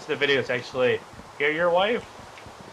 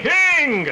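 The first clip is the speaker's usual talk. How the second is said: imitating a squeak; 12 semitones higher, 7 dB louder than usual. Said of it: "Kinda sounded like".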